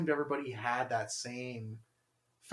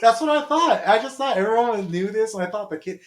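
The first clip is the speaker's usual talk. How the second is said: excited; 8 semitones higher, 12 dB louder than usual. Real, not acted. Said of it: "excited recounting".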